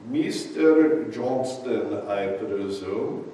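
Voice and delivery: slow, laughing imitation of a heavy German accent; a German innkeeper's heavy accent, lightly mocked through laughter. Innkeeper greeting the late hiker, in a laughing German accent. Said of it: "in this very heavy German accent".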